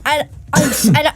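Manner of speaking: normal talking voice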